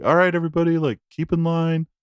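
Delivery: mock restaurant doorman yelling at the queue. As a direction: call out to the line like a doorman. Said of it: "a guy standing outside yelling at everyone".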